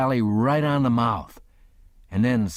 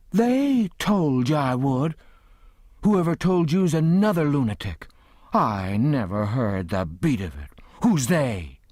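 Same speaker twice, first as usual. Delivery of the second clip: incredulous scolding; 5 semitones higher than usual. A narrator's reading. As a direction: fling the question back at him. Aunt Sally indignant questioning.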